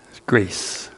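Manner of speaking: usual speaking voice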